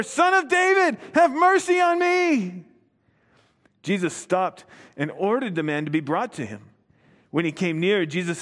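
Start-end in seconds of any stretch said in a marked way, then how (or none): 0.0-2.6 much higher, louder desperate plea, slowing on "mercy"; shouting all the more. Acted. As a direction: cry it over the crowd that shushes you. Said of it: "But he shouted all the more".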